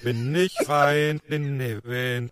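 garbled song played forwards; slurred, warped singing; reversed recording. Shown as a demonstration. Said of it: "rückwärts gesungenes, vorwärts abgespielt". (verzerrt abgespielter Gesang)

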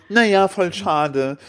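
lightly put-on disappointment, stretched out; mock disappointed. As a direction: imitate a drawn-out "what a pity". Retells the women's disappointed parting.

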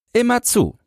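put-on very high child's voice, falling at the end; answering boy. A narrator's reading. Boy confirms he always guards, firm answer. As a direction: confirm it firmly as a little boy.

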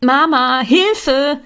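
high, loud, drawn-out shout; calling for help. A narrator's reading. Dragging bags over the threshold, calling for mother. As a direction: call through the house for rescue.

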